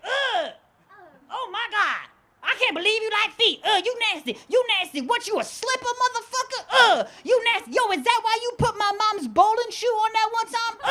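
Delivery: high, mock-disgusted female impression; freaked-out girlfriend. Girl hears of his foot fetish, high freaked-out voice. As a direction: imitate her squealing in horrified disgust. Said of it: "She freaked out. She's like".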